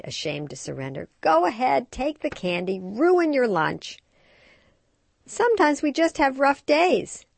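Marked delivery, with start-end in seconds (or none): 1.2-4.0 higher, louder, resigned mom dragging out the end; tired mother surrendering at the checkout; exasperated giving in. Acted. Frustrated mom gives in. 5.3-7.3 higher, louder friendly commiserating; sympathetic. A narrator's reading.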